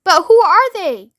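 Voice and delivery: high, loud, drawn-out question; crying out. A narrator's reading. Shanks questioning ground control, baffled cry. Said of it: "cried Shanks".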